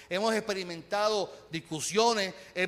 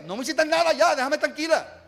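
exasperated mock girlfriend; 6 semitones higher, 6 dB louder than usual. Girlfriend irritated, louder.

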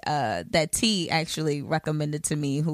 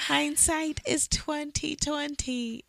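slow, soft, drawn-out musing; 10 semitones higher, 6 dB quieter, much slower than usual. Shown as a demonstration. Reflecting on the surprise pregnancy, slow soft musing. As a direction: let it sink in, much quieter.

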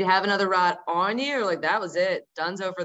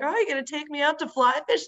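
eager mom; 8 semitones higher, faster than usual. Retold or imitated. Mom voiced asking.

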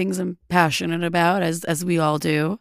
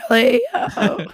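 voice cracking; 5 semitones higher, 4 dB louder, slower than usual. Real, not acted. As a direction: voice breaking up high. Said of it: "I sound like a pubescent boy".

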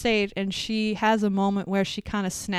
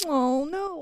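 mild mock dismay; 6 semitones higher than usual. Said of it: "It's really like".

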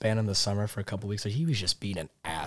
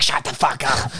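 mock snap; 4 semitones higher, 6 dB louder than usual. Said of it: "He's like".

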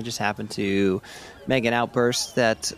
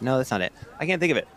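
normal talking voice

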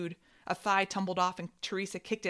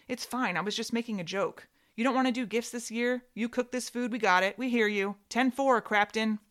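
sarcastic mockery; 5 semitones higher, 5 dB louder than usual. Sister mocks father sarcastically.

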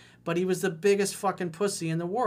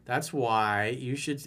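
usual speaking voice

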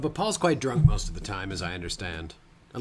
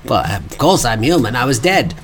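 loud mock protest; 9 dB louder than usual. Mock [name] protests loudly.

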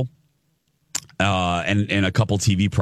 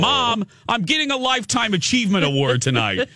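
son protesting; 12 semitones higher than usual. Retold or imitated. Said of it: "and he's like".

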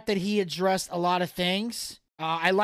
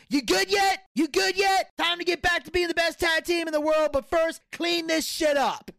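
lightly voiced gruff father, higher; quoting the dad; 6 semitones higher than usual. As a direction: call out like a dad ending a fight. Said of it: "the dad was saying".

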